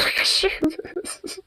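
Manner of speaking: breathy laughing whisper, slowed. Real, not acted. Laughing aside.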